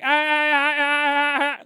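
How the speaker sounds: high, loud repeated wail; loud noise imitation. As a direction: mimic a piercing noise in loud bursts. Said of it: "like this loud noise".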